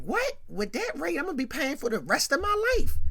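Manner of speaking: high, loud mock outrage; incredulous. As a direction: yelp it like a borrower shocked by the rate. J J shocked by the loan rate.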